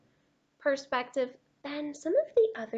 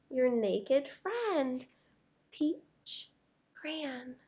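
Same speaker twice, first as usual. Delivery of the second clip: a lightly voiced, mortified crayon; embarrassed peach crayon; 5 semitones higher, 7 dB louder than usual. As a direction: read it like a crayon too shy to come out.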